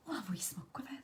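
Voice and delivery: a quiet, unvoiced confiding whisper; whispering a guilty confession to viewers; whispered aside. Real, not acted. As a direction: let the viewers in on a secret, hushed.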